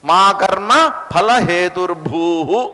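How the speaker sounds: slow reading, "భూః" said bhoo-hu; visarga echoed as hu. Shown as a demonstration. Teacher applies the visarga rule, slow "భూః" ending in hu. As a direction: end "హేతుర్భూః" on a short breathed hu. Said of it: "హూ అని చదవాలి దాన్ని".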